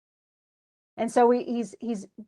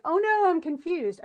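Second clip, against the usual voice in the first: mock confused student; 6 semitones higher, 3 dB louder than usual. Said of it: "And so you're like".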